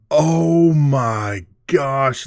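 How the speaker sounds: slow, high, breathy oh my gosh; awestruck. Real, not acted. Collector gasps at a Will Clark patch card. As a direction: marvel at the card.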